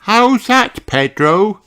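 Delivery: put-on high childlike sheep voice; sweet high young girl voice; little sheep voice. Susie voiced.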